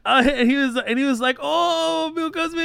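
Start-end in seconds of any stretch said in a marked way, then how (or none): 1.4-2.6 put-on high excited Japanese accent; thrilled officer, Japanese accent. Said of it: "That's what he called it".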